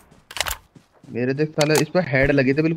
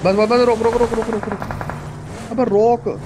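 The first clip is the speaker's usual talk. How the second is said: high urgent yelling; urgent shout; 6 semitones higher than usual. Real, not acted. Urgent gaming callout to stop.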